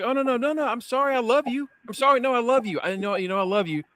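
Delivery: hammed-up pleading liar voice; mock groveling partner. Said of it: "that kind of partner that just like".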